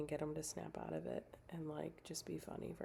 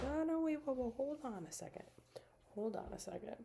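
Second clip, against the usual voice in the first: high, startled "whoa, hold on a second"; mock alarmed stop; 8 semitones higher than usual. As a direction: halt him mid-sentence, pitched way up. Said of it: "I'll be like".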